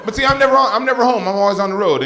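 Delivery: high, loud, protesting he's never home; loud insistence. Acted. Comic telling the crowd he's never home, loud protest. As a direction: shout it defensively, way up.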